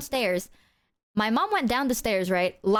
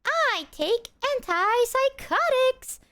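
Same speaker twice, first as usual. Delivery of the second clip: slow, high, put-on voice; mock deadpan reply; 12 semitones higher, slower than usual. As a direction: drag it out in a silly voice. Deadpan reply to ghost fear.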